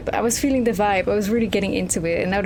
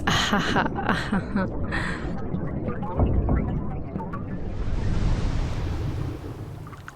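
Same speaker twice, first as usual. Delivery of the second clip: long wheezing laugh; 5 dB quieter than usual. Real, not acted. Cracks up at the pun.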